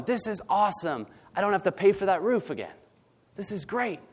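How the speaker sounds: mock delighted, high and quick; tossing off a giddy, cheeky cheer; gleeful friends. Friends cheering over the roof, lightly excited.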